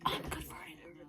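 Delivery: very soft, voiceless whisper; hushed, barely-there confession; conspiratorial whisper. Acted. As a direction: whisper it like a secret. Gleeful confession, whispered first.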